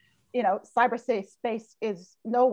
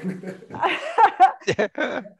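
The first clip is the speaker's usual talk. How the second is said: laughing; 5 semitones higher, 5 dB louder than usual. Real, not acted. Laughs after joke about bankers.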